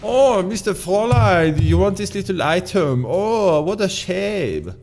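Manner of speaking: high, loud hammed-up German villain; mock German accent. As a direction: taunt in a badly faked German accent, pitched high. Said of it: "also German and he's also French because I can't do accents".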